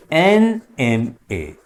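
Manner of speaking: NMA drawn out slowly, एन joined on; slow model reading. Shown as a demonstration. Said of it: "vowel sound एआई".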